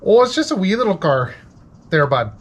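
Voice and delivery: an Irish guy living in Minnesota. Pretend. Irish guy living in Minnesota, mock accent. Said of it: "Irish guy living in Minnesota".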